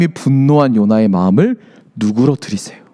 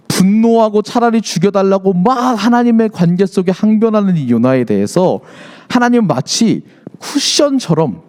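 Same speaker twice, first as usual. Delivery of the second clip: ordinary talk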